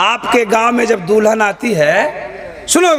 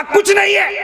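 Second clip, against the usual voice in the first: bearer's refusal; 11 semitones higher than usual. Retold or imitated. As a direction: voice the stubborn bearer higher. (ऊँची आवाज़ में ज़िद्दी मनाही)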